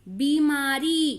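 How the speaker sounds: "बीमारी" slowly, with long ee sounds; long vowel. Shown as a demonstration. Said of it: "ई देर तक हो रहा है".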